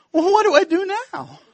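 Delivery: high cracking panicked voice; voice cracked. Retold or imitated. Preacher stuck at the gate asks the guard, voice cracking high. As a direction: squeak it out nervously. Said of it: "my voice cracked".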